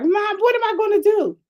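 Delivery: high-pitched wail for mom; exaggerated helpless cry, retold; mock panic. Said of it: "I started to panic".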